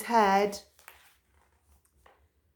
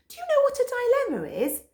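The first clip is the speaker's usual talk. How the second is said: very high, fast quiz; fast high question to listeners; playful aside; 12 semitones higher, 4 dB louder, much faster than usual. A narrator's reading. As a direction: quickly ask the children.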